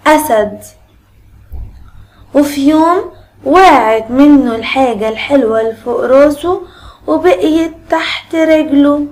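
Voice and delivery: normal talking voice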